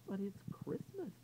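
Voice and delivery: put-on high, soft little girl; lightly voiced young girl. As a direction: speak up sweetly as a small child. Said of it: "said Alice Jane".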